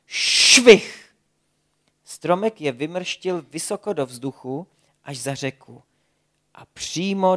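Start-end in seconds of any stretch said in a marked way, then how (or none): 0.1-1.1 high, loud swish effect; loud whooshing sound word. A narrator's reading. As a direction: make the whoosh of the tree. Tree launches them.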